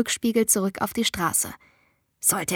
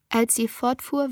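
ordinary delivery

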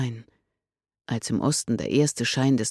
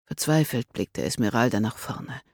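usual speaking voice

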